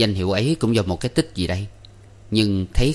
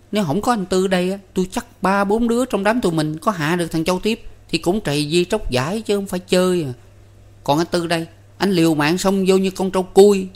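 put-on high, excited boyish voice; eager boy; 11 semitones higher, 3 dB louder than usual. A narrator's reading. Năm tells his sister about the guest's bravery, eagerly. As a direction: pitch it way up and brag eagerly about a friend. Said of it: "cũng hăng hái nói thêm".

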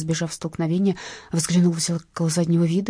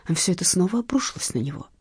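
ordinary delivery

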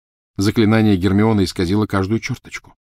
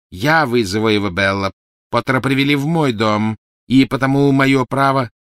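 loud, insistent claim, pitched up; indignant; 5 semitones higher, 6 dB louder than usual. Acted. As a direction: stake the claim against a rival. Quarrel over who summons the master. (громко, с возмущённым напором)

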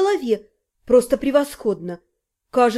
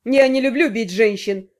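menacing kidnapper; 3 semitones lower than usual. A narrator's reading. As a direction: threaten calmly with a sneer. Kidnapper lectures captive.

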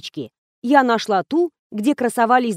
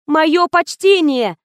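high, loud, drawn-out greeting; yelling; 6 semitones higher, 5 dB louder, slower than usual. A narrator's reading. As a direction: holler to the deaf. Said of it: "заорала я изо всех сил".

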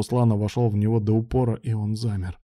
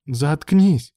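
loud, higher-pitched snapped order; angry outburst; 6 semitones higher, 5 dB louder than usual. A narrator's reading. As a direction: blurt it out angrily. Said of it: "выпалил Алан". (сердито выпалить)

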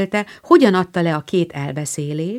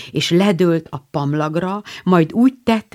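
plain reading voice

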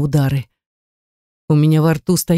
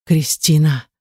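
understated pained moan of a name; slower than usual. A narrator's reading. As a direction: groan the name lightly. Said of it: "застонал Булат".

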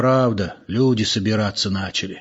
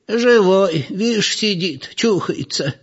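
high, drawn-out granny voice; old woman; 9 semitones higher, slower than usual. A narrator's reading. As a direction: answer like a village granny, slowly. Old woman voiced answering the man.

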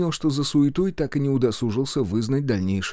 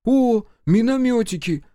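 high, loud, drawn-out cooing; greedy delight; 12 semitones higher, 5 dB louder than usual. A narrator's reading. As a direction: squeal slowly over a coveted find. Supply officer spots coveted mortars. (восторженно, высоко и протяжно)

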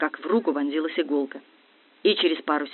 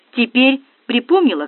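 raised, louder needling question; sarcastic; 6 semitones higher, 7 dB louder than usual. A narrator's reading. Дегтярев needles her about her memory, sarcastically. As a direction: press with a touch of sarcasm. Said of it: "съехидничал Дегтярев".

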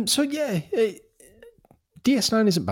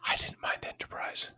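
whispering; 13 dB quieter than usual. Real, not acted. Quiet aside, unvoiced.

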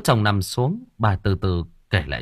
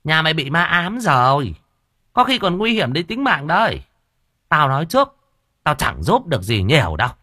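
higher, louder village shaman; stern medium; 6 semitones higher, 4 dB louder than usual. Acted. Medium warns the kneeling wife about ghosts, stern and loud. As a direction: warn her off bluntly.

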